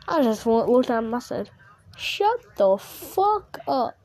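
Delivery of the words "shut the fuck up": high, very drawn-out telling-off; exasperated retold retort. Telling off the friend who preached about cussing, exasperated retort. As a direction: stretch each word out slowly, pitched up.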